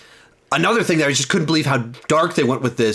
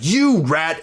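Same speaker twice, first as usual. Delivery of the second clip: mock-disgusted accusation, stretched YOU lifted high; Oswald, disgusted; 4 semitones higher than usual. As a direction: imitate an indignant accusation, a little raised in pitch. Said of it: "he's just disgusted by that".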